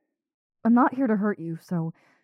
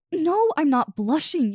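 flustered loud denial; 9 semitones higher, 5 dB louder than usual. Acted.

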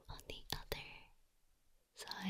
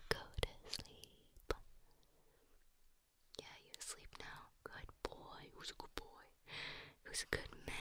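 soothing bedtime whisper; 10 dB quieter than usual. Pretend. Lulling listener to sleep.

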